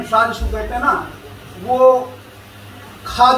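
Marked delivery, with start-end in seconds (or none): 3.1-3.3 cerebral sibilant pronounced as खा. Shown as a demonstration. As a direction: say खा, a "kha" for "sha". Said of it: "जैसा उच्चारित होता है".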